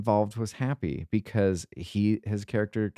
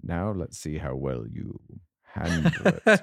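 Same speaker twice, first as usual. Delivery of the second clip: movie villain voice; 4 semitones lower than usual. Acted. Movie quote impression.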